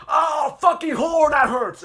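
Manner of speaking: high, loud yelp of pain; pained shout. Retold or imitated. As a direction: yell it like the tooth just stabbed. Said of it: "he's like".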